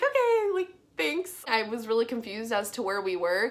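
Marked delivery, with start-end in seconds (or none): 0.0-1.4 high-pitched mock-polite acceptance; awkwardly high, insincere thanks; sarcastic thanks. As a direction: pitch it way up, humoring them. Sarcastic thanks for same handcuffs.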